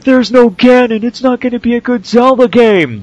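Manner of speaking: high, loud whiny fan voice; mocking whiny fans. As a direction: whine like an upset fan. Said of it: "people were like".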